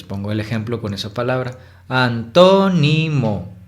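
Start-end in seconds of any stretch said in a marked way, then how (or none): 1.9-3.5 antónimo very slow, TÓ raised high and loud; exaggerated stressed syllable. Shown as a demonstration. Teacher giving an example to find the tonic syllable, exaggerated TÓ in antónimo. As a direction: stretch antónimo, climb on tó, then drop back. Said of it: "exagerar la pronunciación".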